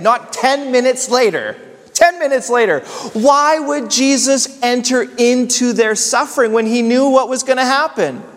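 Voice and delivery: high-pitched, insistent pulpit questioning; preacher pressing an incredulous why; impassioned preaching. Real, not acted. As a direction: drive the question home with rising intensity.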